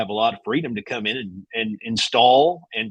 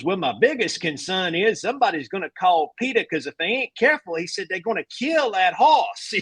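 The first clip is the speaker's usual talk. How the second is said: put-on higher, drawling old coach joke; coach Donnelly voice; 7 semitones higher than usual. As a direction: deliver it like a dry country coach. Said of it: "He goes".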